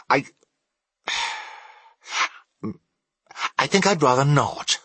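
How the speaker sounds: hesitant, with a sighed pause, then hurried; faltering. A narrator's reading. Scrooge timidly declines the three spirits, faltering. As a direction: stall on the first word, then rush the refusal. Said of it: "in a faltering voice".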